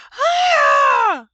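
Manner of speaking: very loud, high, long "ah"; hammed-up child's shriek; mock scream. A narrator's reading. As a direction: demonstrate a big gasping scream. Boy demonstrates his noise.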